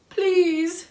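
high, loud plaintive appeal; upset plea. A narrator's reading. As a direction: beg in distress. Upset character pleads.

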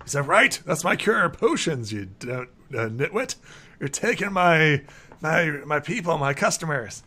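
agitated, stumbling acted complaint; not-quite-sane alchemist. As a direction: sputter like a cranky shopkeeper losing his customers. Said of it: "alchemist who's not quite sane".

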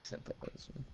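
breathy whisper, no voice. Pretend. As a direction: whisper the name like a secret. Whispered echo of the name.

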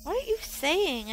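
shocked and a little scared; startled. Acted. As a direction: blurt it out in shock. Boy startled by her claim.

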